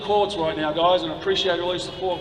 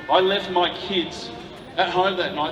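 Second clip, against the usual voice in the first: usual speaking voice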